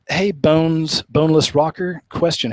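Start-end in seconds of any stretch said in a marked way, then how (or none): none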